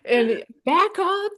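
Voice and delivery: high, carrying call upward; yelling up. Retold or imitated. Photographer calling poses up the stairwell, high, carrying call. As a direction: direct someone several floors up. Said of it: "And I was just yelling up to her".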